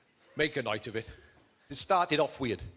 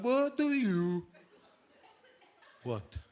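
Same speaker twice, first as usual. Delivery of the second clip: hammed-up sneer; 5 semitones higher, much slower than usual. Waiter repeating his question to the husband, a slow mocking drawl. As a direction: stretch it out mockingly.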